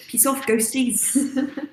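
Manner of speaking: put-on playful, drawn-out New Zealand accent; attempted New Zealand accent. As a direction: shoo them off in a jokey New Zealand accent. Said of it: "I can't do the New Zealand access".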